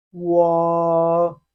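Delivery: loud, held wo for students to copy; Chinese wo. Shown as a demonstration. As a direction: model wo clearly. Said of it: "Repeat after me".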